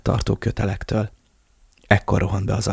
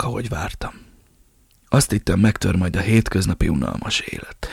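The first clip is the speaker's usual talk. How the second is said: faint, breathy whispered confession; an old boss confessing on his deathbed; dying old man. Acted. Dying Ramirez continues confession. (haldokló öregember suttogása)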